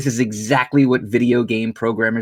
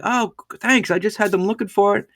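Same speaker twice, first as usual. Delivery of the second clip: mock stranger; 9 semitones higher than usual. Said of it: "He was like".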